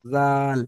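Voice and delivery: "জাল" with a light, thin Z and long A; thin pronunciation. Shown as a demonstration. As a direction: say "জাল" thinly, drawing out the vowel. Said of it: "পাতলা করে উচ্চারণ হবে". (পাতলা করে "জাল")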